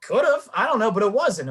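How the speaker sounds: high, loud mock insistence; teasing. Real, not acted. Host teasing his friend about the blood. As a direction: fire it back playfully.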